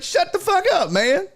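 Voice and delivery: very high, exasperated mock yell; high-pitched retort at normal volume; retold heckler comeback. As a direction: act out telling a heckler off, pitch way up. Repeats his comeback to the heckler.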